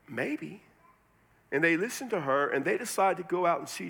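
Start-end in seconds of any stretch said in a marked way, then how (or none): 0.1-0.6 soft, tentative reply; mock hesitance, said softly; doubtful maybe. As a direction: answer softly, not yet convinced. Acts out the townspeople's doubtful answer.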